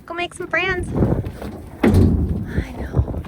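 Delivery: high, loud cheerful pet voice; talking to a horse. Real, not acted. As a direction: coax the animals warmly along. Unloading horses, coaxing them home.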